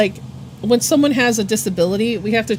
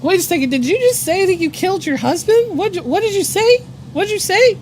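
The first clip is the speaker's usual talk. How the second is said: high-pitched, incredulous questioning; acted shock; 9 semitones higher, 3 dB louder than usual. Worker questioning a coworker about a killing, shocked. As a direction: pitch climbs in hammed-up disbelief. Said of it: "I was, like, shocked at what I heard".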